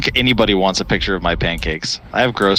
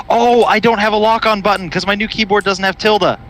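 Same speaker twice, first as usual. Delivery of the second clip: distressed outburst, pitched way up; real dismay; 11 semitones higher than usual. Real, not acted. Gamer finds lock-on button missing, real dismay.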